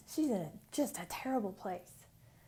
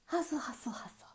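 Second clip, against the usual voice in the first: whispered hustle; 5 semitones higher than usual. Acted.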